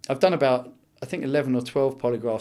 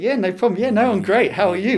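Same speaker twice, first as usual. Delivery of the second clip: higher, louder through gritted teeth; 7 semitones higher, 6 dB louder than usual. Shown as a demonstration. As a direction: force cheer through clenched teeth. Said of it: "talking through gritted teeth".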